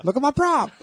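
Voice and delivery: very high squeaky doll voice; pull string toy. Retold or imitated. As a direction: squeal it like a cheap talking toy. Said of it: "What does he say when you pull the string".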